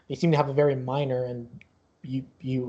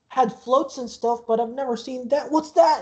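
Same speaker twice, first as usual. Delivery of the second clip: put-on higher-pitched childlike amazement; excited kid; 9 semitones higher than usual. Yamu spotting the roller coaster, amazed and excited. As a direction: marvel like a kid, rising on the question.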